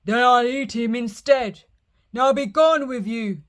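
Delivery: loud troll dismissal; loud troll sending the goat away. Acted. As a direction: shoo him off loudly.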